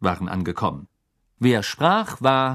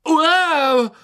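put-on very high, loud girlish Wow; shocked exclamation; 7 dB louder than usual. A narrator's reading. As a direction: squeal out in surprise. Lavender exclaims at creatures. (schriller Ausruf, sehr hoch und laut)

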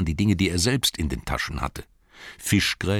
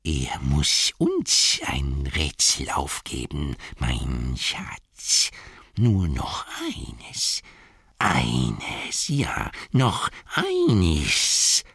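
slow, hissing creature pleading; Gollum creature voice; 3 semitones lower, much slower than usual. Acted. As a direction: drag it out like a hungry cave creature. Gollum voices his demand. (langsame, lauernde Gollum-Stimme)

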